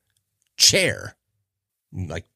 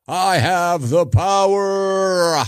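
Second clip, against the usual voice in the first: high, drawn-out heroic declaration; He-Man; 10 semitones higher, 3 dB louder, much slower than usual. Retold or imitated. Host quoting He-Man's transformation line, high and slow. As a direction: proclaim it slowly like a cartoon hero. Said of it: "by uttering the words".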